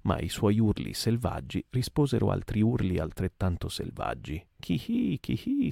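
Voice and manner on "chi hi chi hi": very high shrieking whoop; shrieked cry. Acted. Daggoo answering the mate with a very high shriek. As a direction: squeal it out wildly. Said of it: "strillò Deggu".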